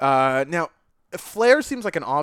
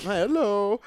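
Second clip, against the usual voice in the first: Craig voice; 4 semitones higher, 3 dB quieter than usual. Pretend. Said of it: "That was me being what I imagine is".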